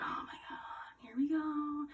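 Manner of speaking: whispered, resigned groan; whispered dread. Retold or imitated. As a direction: breathe it out dreading it. Groaning at news.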